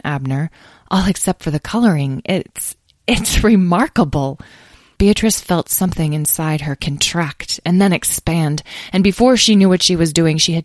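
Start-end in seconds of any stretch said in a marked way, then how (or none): none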